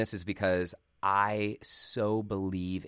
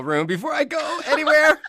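much higher, louder laughing words; 11 dB louder than usual. Real, not acted. Host laughing about hiding in the hotel room. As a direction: laugh through it.

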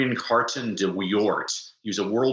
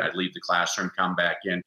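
ordinary talk

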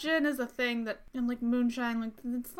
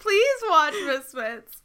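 slow, louder begging through laughter; laughing plea; 3 semitones higher, 6 dB louder, slower than usual. Real, not acted. Co-host urging a friend to watch a show, laughing plea. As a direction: plead while barely holding in laughs.

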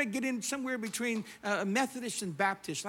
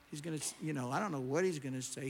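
usual speaking voice

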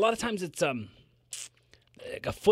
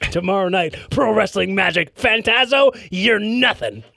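wrestling promo; 2 semitones higher than usual. Pretend. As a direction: call him out like a heel.

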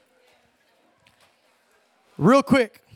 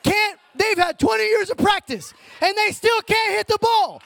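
louder, higher, building worked-up rant; passionate rant; 6 semitones higher, 3 dB louder than usual. Shown as a demonstration. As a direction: vent like you really mean it. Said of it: "as passionately as you can".

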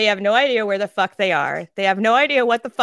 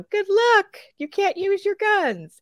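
sarcastic good luck; 12 semitones higher, slower than usual. Retold or imitated. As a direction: sneer it like a useless blessing. Mocking the commanders again.

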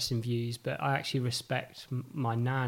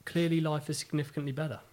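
usual speaking voice

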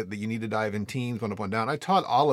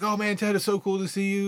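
high-pitched, delighted retold hello; excited greeting; 8 semitones higher than usual. Student running into his old instructor, excited. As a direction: gush like a happy former student.

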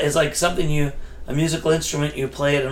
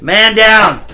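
mock alarm call; 6 semitones higher, 8 dB louder than usual. Bottle cap dropped.

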